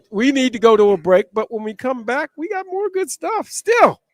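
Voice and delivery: building to a big, drawn-out station shout; high, loud announcer flourish at the end; radio announcer. Real, not acted. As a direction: sell the station name into the break. Host's station ident before break.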